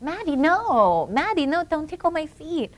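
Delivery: a mother mimicking her baby girl's high squeal; imitating toddler daughter. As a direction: play the giggly toddler protesting.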